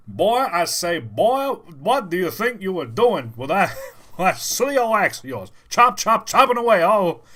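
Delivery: high, loud Southern rooster blowhard; Foghorn Leghorn. Acted. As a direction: bluster like a cartoon rooster. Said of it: "my Foghorn Leghorn impression".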